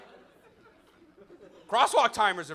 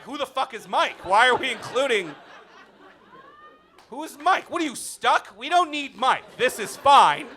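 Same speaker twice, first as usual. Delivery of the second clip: panicked protest; 7 semitones higher, 7 dB louder than usual. Retold or imitated. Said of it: "And I was like".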